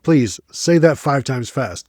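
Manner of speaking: normal talking voice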